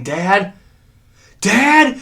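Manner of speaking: a hint of a son calling out, rising high; lightly voiced son calling. Son calling to his dad at the cliff, mild. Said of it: "Travis saying".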